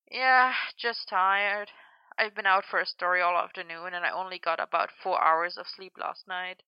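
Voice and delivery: soft, worn-out voice, dragging at the start; too tired to put any volume into it; quietly drained; exhausted. A narrator's reading. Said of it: "sounding scratchy and exhausted".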